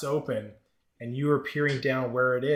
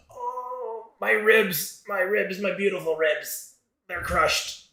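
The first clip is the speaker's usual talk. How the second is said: high, hammed-up moan of agony; pained wailing; 7 semitones higher, slower than usual. Injured character wakes with crushed ribs, theatrical pained wail.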